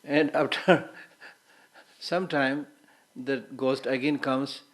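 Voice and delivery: ordinary talk, nothing marked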